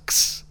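"کس" said as k plus s; English X sound. Shown as a demonstration. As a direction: say "کس" like English x, a k running into s. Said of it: "در زبان انگلیسی صداش هست".

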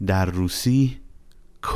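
ordinary delivery

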